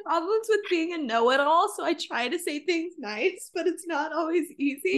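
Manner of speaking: high, loud, through laughter; laughing while talking. Real, not acted. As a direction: talk while cracking up. Laughing at friend's mistake.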